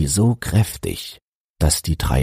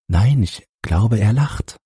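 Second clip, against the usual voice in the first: lightly voiced mother; 6 semitones higher than usual. A narrator's reading. Mother answers husband, high.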